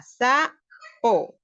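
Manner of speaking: "Sa, po" as two separate, loud syllables. Shown as a demonstration. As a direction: break the word into "Sa" and "po", each punched out. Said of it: "ponerle el fonema sa".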